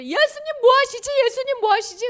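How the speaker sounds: high, loud, urgent question; frantic disciples. Acted. Disciples looking for Jesus in the storm, acted frantic calling. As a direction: act out frantic searching, pitched right up.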